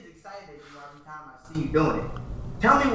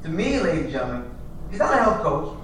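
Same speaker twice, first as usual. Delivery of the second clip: loud, building emcee build-up; big stage introduction of the guest; announcer hype; 9 dB louder than usual. Acted. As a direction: introduce him like a ring announcer. Hyping guest to audience.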